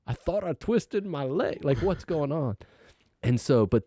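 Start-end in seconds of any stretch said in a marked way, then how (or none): 0.1-2.6 bewildered. Retold or imitated. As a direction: sound lost and confused. Confused among five doctors.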